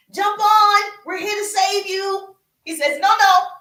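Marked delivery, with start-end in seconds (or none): 0.1-2.3 high, loud rescuer's shout; calling out. Acted. Rowboat rescuers to the man in the flood, calling out. 3.0-3.5 high, loud double no; emphatic refusal. Acted. Flood victim turning down second rowboat, emphatic.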